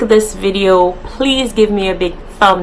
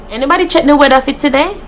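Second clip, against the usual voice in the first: fast, higher Jamaican patois call with weather as "weda"; quick, higher Jamaican asking around; 5 semitones higher, much faster than usual. Shown as a demonstration. YouTuber acting out a Jamaican calling out, fast.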